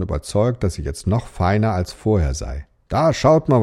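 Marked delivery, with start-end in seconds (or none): 2.9-3.6 hoch und laut hinüberrufen; rufen. Acted. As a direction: den anderen Jungen etwas zeigen wollen. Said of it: "rief einer der Jungen". (Junge ruft seinen Freunden laut zu)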